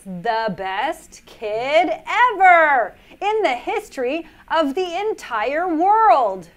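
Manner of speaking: grand praise. Acted. Reader praising the kid listening, grand and slow. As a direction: proclaim it like big news.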